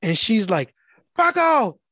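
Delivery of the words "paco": high-pitched calls for someone missing; mimicking a woman hollering a name; imitated calling out.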